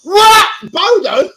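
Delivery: high, loud mock outraged shriek; imitating Gemma. Gemma overhearing something rude, imitated in a high, loud outraged shout. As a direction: play his partner catching him out, hammed up. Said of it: "she'll be like".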